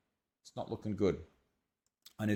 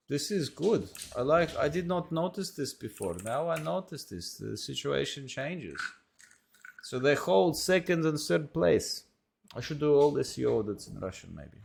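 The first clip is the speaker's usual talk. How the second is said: a light, hammed-up Russian accent, higher than usual; mock Russian accent; 5 semitones higher than usual. Vlogger gloating over a competitor's SEO, light mock Russian accent. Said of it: "Notice the Russian accent comes out".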